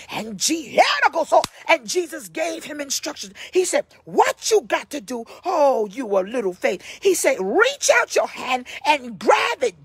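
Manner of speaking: forceful, driving sermon voice; full-throated church exhortation; fervent preaching. Real, not acted. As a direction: preach it hard, punching and stretching key words.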